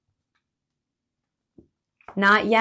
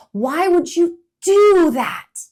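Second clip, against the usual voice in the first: much louder, high, slow protest; indignant squirrel; 9 semitones higher, 11 dB louder, slower than usual. Acted. Indignant squirrel protest.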